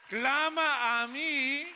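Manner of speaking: long, drawn-out high shout; loud, high preacher's call; crying out. Shown as a demonstration. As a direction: cry it out with full force, stretching each word. Demonstrates crying out to God.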